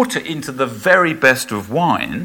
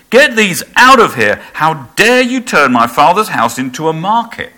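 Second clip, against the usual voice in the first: angry shout; 6 dB louder than usual. Acted. Jesus to the dove sellers, a loud angry shout. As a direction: drive the traders out with a furious cry. Jesus drives out temple traders.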